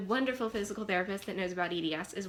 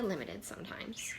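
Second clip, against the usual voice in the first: whispered aside; 8 dB quieter than usual. Real, not acted. Trailing off, whispered.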